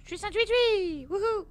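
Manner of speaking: thrilled to be a bird; whooping with delight; excited. Real, not acted. Bird-flying game moment.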